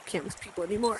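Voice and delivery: very soft, fast fed-up mutter; exasperated sigh. Acted. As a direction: give up with a sigh. Villain gives up.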